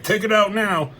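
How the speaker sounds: retold impatient shout; Hawkeye. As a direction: imitate someone yelling an urgent command. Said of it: "But Hawkeye's still yelling".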